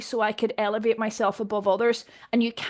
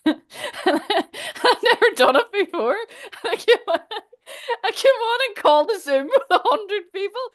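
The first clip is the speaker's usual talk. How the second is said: real laughter breaking through, high-pitched; laughing; 10 semitones higher, 4 dB louder than usual. Real, not acted. Confessing a first-ever channeling Zoom, laughing. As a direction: tell it through helpless laughter. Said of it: "I shouldn't be laughing".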